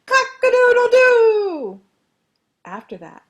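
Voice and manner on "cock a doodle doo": full-force, high rooster crow; very loud crow. A narrator's reading. Rooster finally crowing, very loud indeed. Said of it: "And then very loud indeed".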